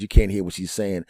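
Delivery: ordinary talk, nothing marked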